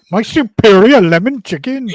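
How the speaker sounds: high, loud, drawn-out boast through laughter; mock declamation. Friend joining in the meme parody, loud laughing declamation. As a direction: crow it slowly, hammed up and laughing. Second speaker joins the parody.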